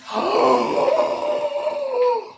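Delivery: very high shocked gasp; huge gasp. Real, not acted. Streamer hears pets can earn money, huge gasp. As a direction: gasp like you just won.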